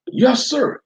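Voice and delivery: high, loud snappy yes sir; deputy answering the sheriff; deputy's reply. Acted. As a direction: answer the boss crisply. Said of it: "he replied".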